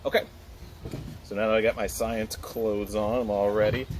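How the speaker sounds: normal talking voice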